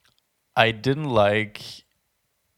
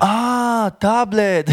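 Ah, tablet in a much higher, louder surprised voice; sudden dawning realization, pitched way up; friend finally getting it; 13 semitones higher, 6 dB louder, much slower than usual. Retold or imitated. The Italian friend finally understanding the word tablet, much higher and louder. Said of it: "until he was like".

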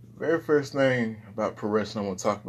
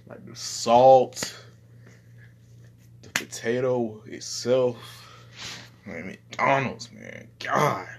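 slow savoring; 3 semitones higher, much slower than usual. Real, not acted. Savoring McDonald's fries.

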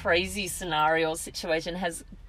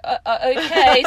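flustered; 8 semitones higher, 12 dB louder than usual. Retold or imitated. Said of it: "And I was like".

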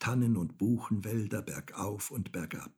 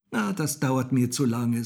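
louder, raised complaint; impatient; 4 semitones higher, 6 dB louder than usual. A narrator's reading. Häwelmann tired of waiting for people, impatient. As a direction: brush it off impatiently.

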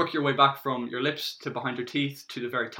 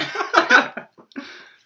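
laughing; 12 dB louder than usual. Real, not acted. Laughs at failed demo.

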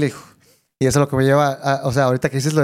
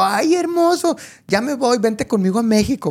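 much higher, sweet cooing woman's voice; imitating Fey cooing; 8 semitones higher, slower than usual. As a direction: coo it like a singer charming a child. Fey speaking to the boy. (imitando a Fey, voz aguda y melosa)

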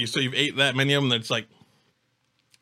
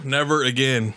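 usual speaking voice

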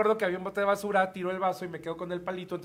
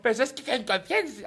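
mock Argentine accent; 3 semitones higher than usual. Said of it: "aparte era argentina".

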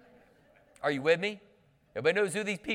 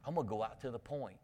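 Matthew McConaughey drawl; 3 semitones lower, 9 dB quieter, faster than usual. Retold or imitated. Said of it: "but he talked like this".

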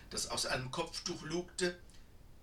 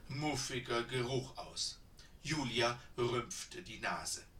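ordinary delivery, nothing marked

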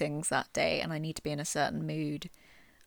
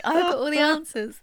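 grand proclamation; 9 semitones higher, 8 dB louder than usual. Retold or imitated.

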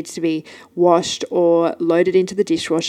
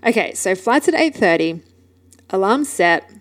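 ordinary talk, nothing marked

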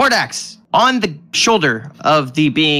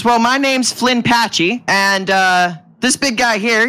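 higher, folksy little-man voice; little bald man; 6 semitones higher than usual. Acted. Tiny man introducing himself to travelers, higher character voice. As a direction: introduce yourself as a tiny, chatty fellow.

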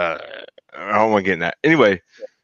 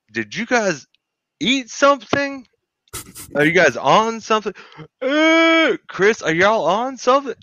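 frantic questioning; 12 semitones higher than usual. Retold or imitated. Retold panic at friends at night.

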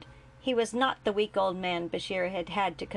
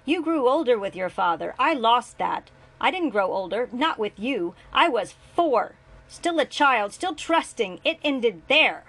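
high, loud, hurt outburst; hurt rage; 4 semitones higher, 7 dB louder than usual. A narrator's reading. Julian to his father, loud and hurt. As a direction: let the lost childhood burst out.

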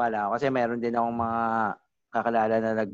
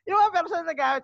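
laughing. Real, not acted. Laughing through his point.

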